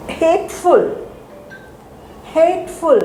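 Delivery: hateful with the weight on hate; stress on the first syllable. Shown as a demonstration. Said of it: "where am I stressing".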